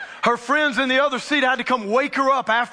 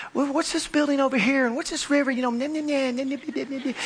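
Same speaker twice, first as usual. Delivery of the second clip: higher, light chattering imitation; chatty seatmate; 5 semitones higher, faster than usual. Woman next to him on the plane, mock chatty prattle. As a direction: prattle on, trailing into nonsense syllables. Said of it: "Just chatty, chatty, chatty".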